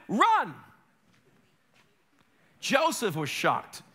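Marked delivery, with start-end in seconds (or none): 0.1-0.5 high, loud cry of run; sudden loud command; urgent shout. Real, not acted. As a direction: yell the warning to flee. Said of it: "you know what you need to do".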